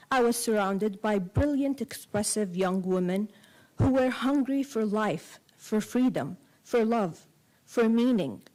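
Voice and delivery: ordinary talk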